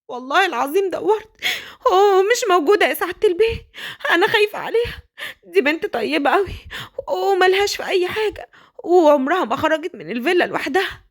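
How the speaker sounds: high, anxious pleading; worried old nanny. Acted. Nanny telling the father the girl is missing, high and anxious. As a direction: beg the master to worry too.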